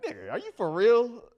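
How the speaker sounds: high, loud disbelieving retort; incredulous. Retold or imitated. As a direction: pitch it way up in disbelief.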